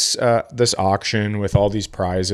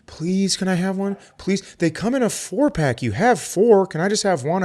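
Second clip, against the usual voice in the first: put-on pleading, higher and childish; whiny little sister; 8 semitones higher than usual. Little sister begging for a Cadbury egg, high and whiny. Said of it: "she'd go like".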